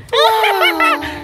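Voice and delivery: high, airy giggle bursting out; a squealing laugh; burst of laughter. Real, not acted. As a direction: laugh at your own prank idea. Laughing at surprise plan.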